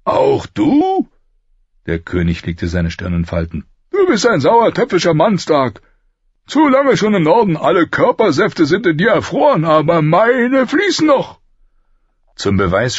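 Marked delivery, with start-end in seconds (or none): 0.1-1.1 high, sharply raised question; indignant disbelief. A narrator's reading. King reproaching Ned for siding against him, indignant. King rounds on his friend. 3.9-11.4 loud, high royal bluster; blustering King Robert, irritated. A narrator's reading. King berating Ned as a dour northerner, blustering.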